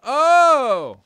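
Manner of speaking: loud high startled oh; startled outcry; surprised shout. Real, not acted. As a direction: gasp it out loud. Sudden surprise.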